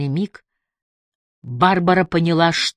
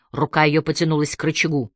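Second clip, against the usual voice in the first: plain reading voice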